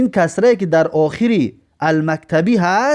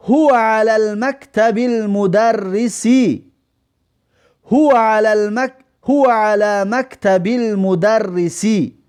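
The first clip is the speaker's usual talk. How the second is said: slow Arabic, هو raised and stretched; teacher's slow Arabic dictation; slow model reading; 3 semitones higher, slower than usual. Shown as a demonstration. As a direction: read the sentence slowly for students to copy. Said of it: "هو یعنی که".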